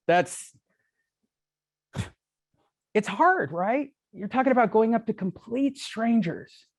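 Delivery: ordinary delivery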